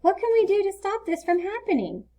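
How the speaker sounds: put-on young boy Leo. A narrator's reading. Leo telling Mom about his lost fort, lightly voiced boy. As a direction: raise the voice into an eager little boy.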